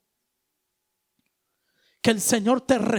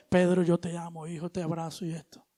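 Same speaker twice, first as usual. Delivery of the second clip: much softer, gentle and unhurried; Christ's calm comfort; 5 semitones lower, 6 dB quieter than usual. Retold or imitated. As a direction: reassure Pedro tenderly amid the storm. Christ comforting Pedro again. (Cristo consuela a Pedro con voz baja y tierna)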